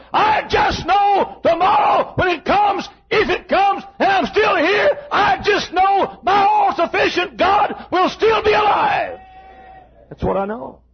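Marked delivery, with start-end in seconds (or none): 0.1-9.2 much higher, slow shouted declaration; shouted preaching. Real, not acted. Preacher to congregation, shouting in faith. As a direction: proclaim faith at the top of the voice.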